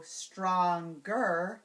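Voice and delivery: STRONGER very slow, in two beats; syllable by syllable. Shown as a demonstration. Teacher isolating the last syllable, stronger slowly split. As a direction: separate stronger into stron and ger. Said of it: "the last syllable".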